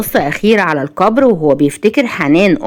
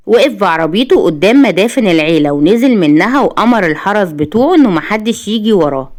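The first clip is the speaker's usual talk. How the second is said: usual speaking voice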